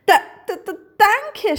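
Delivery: high, loud stuttered thanks; stammering. A narrator's reading. Little hare thanks the rainbow, high stammer. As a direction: stumble on the first sound before getting it out. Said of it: "stammelte Pieps".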